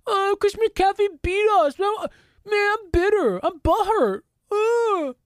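high, whiny mock of a sulking rival; mocking bitter player. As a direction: ham up a butthurt opponent whining. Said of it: "were they butthurt they got their ass kicked".

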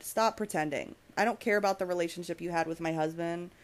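usual speaking voice

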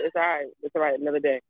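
low, soft, unbothered reassurance; nonchalant husband. Retold or imitated. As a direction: imitate a husband calmly shrugging it off. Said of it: "he's just so nonchalant about".

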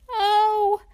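startled by something very close; frightened gasp of "Oh". Acted. Reader voices Tiny hearing a noise nearby, startled.

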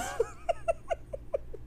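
long squeaky giggle; high giggle. Real, not acted. Host giggling after a listener called her out.

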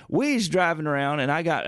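normal talking voice

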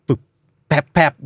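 short beatboxed disco hits; disco beat imitation. Shown as a demonstration. Podcaster showing a generic disco pattern, beatboxed hits. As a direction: mouth a basic disco drum pattern. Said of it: "ดิสโก้".

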